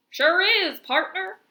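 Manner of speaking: a friendly cowboy drawl, hammed up; country accent. Teacher answering the student, in a hammed-up country accent. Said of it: "this one's country".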